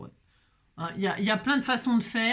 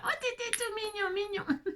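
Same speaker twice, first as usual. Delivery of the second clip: high, soft cooing voice; 11 semitones higher, 5 dB quieter than usual. Real, not acted. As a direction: coo at it tenderly. Cooing at sticker. (voix attendrie, aiguë)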